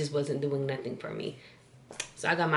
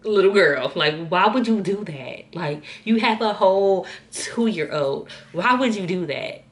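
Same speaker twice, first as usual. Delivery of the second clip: exasperated mom impression, louder and stretched; imitated scolding mom; 3 semitones higher, 6 dB louder than usual. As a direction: scold her like a mother who can't believe it. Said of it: "she was looking at me like".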